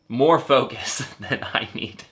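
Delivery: ordinary delivery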